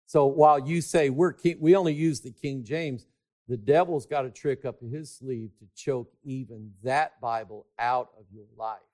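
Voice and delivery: normal talking voice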